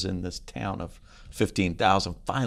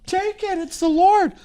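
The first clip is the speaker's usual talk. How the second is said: very high falsetto, swelling louder; squealed mock urgency; urgent urging; 4 dB louder, slower than usual. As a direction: urge it way up high, building to loud. Acting his urgent reply to wife.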